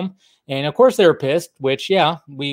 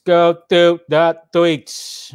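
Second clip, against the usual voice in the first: very slow, stretched announcer call; drawn-out catchphrase; 2 semitones higher, 3 dB louder, much slower than usual. Acted. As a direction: stretch every word like a show host. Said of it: "segment catchphrase, drawn out".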